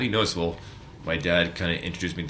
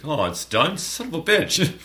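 surprised; 10 semitones higher, 4 dB louder than usual. Retold or imitated.

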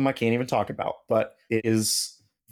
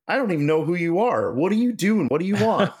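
baffled retort; 6 semitones higher than usual. Retold or imitated. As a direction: push back, bewildered and exasperated. Baffled retort to pushy stranger.

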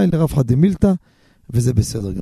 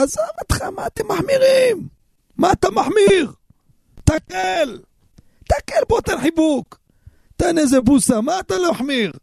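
mocking voice; 12 semitones higher than usual. Preacher imitating scoffers at the strict, high mock voice. Mocking lax Shabbat attitude.

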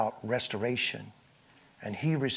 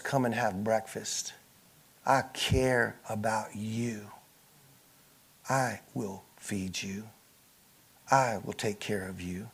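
tender Jesus; 4 semitones lower, 6 dB quieter than usual. Acted. Jesus inviting the disciples to breakfast, tender and soft. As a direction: speak as a caring shepherd would.

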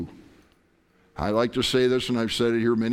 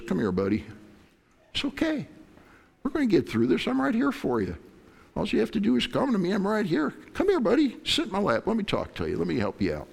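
gentle dad; 9 semitones higher, faster than usual. Pretend. Dad comforting his scared child.

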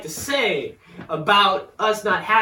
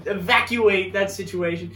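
ordinary talk

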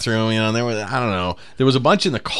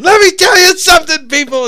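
very high, loud yell; comic's opening rant; 9 dB louder than usual. Retold or imitated. Burr walks out and yells at the crowd, loud. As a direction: bellow it at the crowd. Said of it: "so he just walked out".

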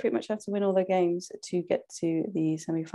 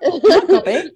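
laughing protest; 6 semitones higher, 11 dB louder, slower than usual. Real, not acted.

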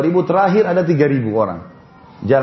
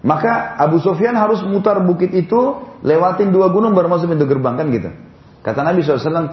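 ordinary talk, nothing marked

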